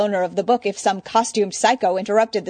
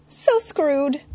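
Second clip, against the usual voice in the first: dismayed; 8 semitones higher than usual. Acted. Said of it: "Eddie grimaced".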